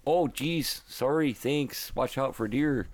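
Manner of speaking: high, exaggerated Wisconsin accent; hammed-up Midwestern Wisconsin lilt. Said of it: "I was really disappointed that".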